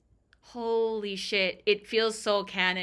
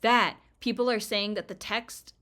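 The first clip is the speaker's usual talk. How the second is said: normal talking voice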